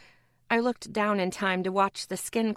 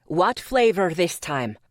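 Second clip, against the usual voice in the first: put-on Scottish accent; 3 semitones lower, 5 dB louder than usual. A narrator's reading. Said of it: "thick Scottish accent".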